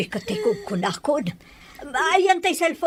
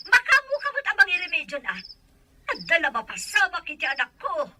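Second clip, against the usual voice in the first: higher-pitched angry demand; angry scolding; 4 semitones higher than usual. Acted. Mother ordering the man to find a remedy, angry. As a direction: lay down a threat to him.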